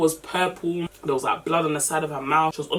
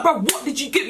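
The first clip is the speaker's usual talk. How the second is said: high-pitched, agitated demand; angry questioning; 8 semitones higher than usual. Retold or imitated. Questioning the guy about the girl on the floor, acted anger. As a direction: act out an angry interrogation, pitched up. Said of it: "You know when you're angry".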